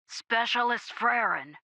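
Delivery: drawn-out gruff radio call; gruff sergeant, insistent. A narrator's reading. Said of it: "The gruff voice came again".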